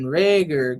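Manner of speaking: usual speaking voice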